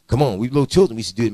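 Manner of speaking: usual speaking voice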